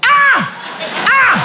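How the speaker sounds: very high triumphant "Ah"; high exclamation. Real, not acted. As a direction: catch the crowd out. Mocking audience reaction. (« Ah ! » très aigu et triomphant)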